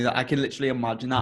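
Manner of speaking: ordinary delivery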